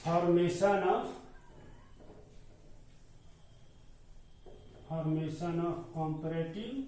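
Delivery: each word drawn out slowly for notes; slow dictation. Shown as a demonstration. Teacher to class, slow dictating voice. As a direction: dictate the heading slowly so students can write.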